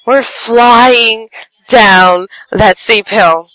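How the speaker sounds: ordinary delivery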